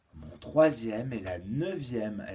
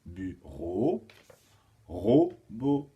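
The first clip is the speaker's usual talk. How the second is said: "Bureau, robot" in separate syllables, RO held; syllables split; 4 semitones lower, 4 dB louder, much slower than usual. Shown as a demonstration. Teacher shows last syllable becoming first, "Bureau, robot" split, RO stressed. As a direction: break up "Bureau, robot" to show the shared RO. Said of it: "on prend la dernière syllabe d'un mot".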